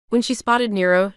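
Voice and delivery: ordinary delivery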